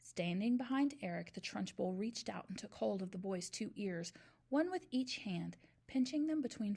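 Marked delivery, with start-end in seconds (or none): none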